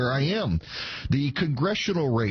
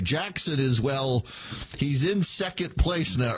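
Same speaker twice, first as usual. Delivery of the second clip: normal talking voice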